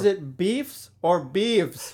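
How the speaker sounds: slow, careful question for a voice assistant; slow clear question. Acted. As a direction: speak slowly to the phone. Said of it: "Let me ask Google".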